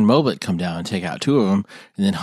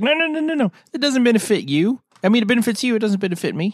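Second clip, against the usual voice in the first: high mocking voice; 10 semitones higher, faster than usual. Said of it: "And he's like".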